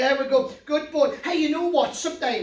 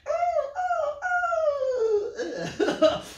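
high laugh. Real, not acted. Laughing at own puppet joke.

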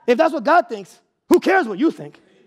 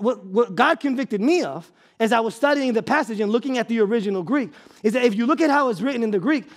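ordinary talk, nothing marked

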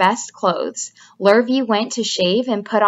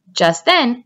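plain reading voice

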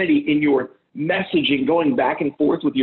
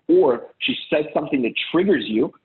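normal talking voice

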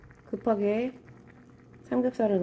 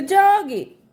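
sudden high loud call; 10 semitones higher, 11 dB louder, much slower than usual. Real, not acted. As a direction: blurt it out loud. Suddenly calls out.

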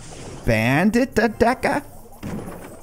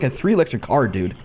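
ordinary talk

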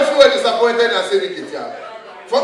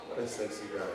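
very low, soft, breathy admiring purr; sultry cat purr; 10 semitones lower, 19 dB quieter than usual. Pretend. Comedian voicing a cat admiring an intruder, low sultry purr. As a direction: coo it like a flirting cat.

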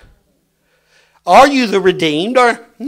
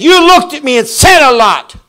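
louder, higher angry retort; daddy shouting; 7 semitones higher, 7 dB louder than usual. Retold or imitated. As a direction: shout it back at the boy. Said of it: "He said".

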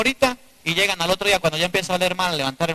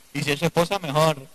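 ordinary talk

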